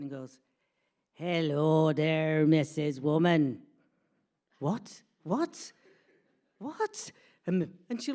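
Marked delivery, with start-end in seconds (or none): 1.1-3.6 loud, long-drawn slow man's voice; booming, drawled mock greeting; chap's greeting. Chap leaning over, acted out. 4.6-7.1 questions climbing higher each time; mounting mock bewilderment; stunned disbelief. Stunned repeats, rising each time.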